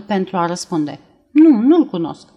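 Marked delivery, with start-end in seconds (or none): 1.3-2.3 a louder, insistent no; denying too firmly, a beat too late; emphatic denial. A narrator's reading. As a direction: push the denial out hard. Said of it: "spuse Elvira, care așteptase un pic prea mult".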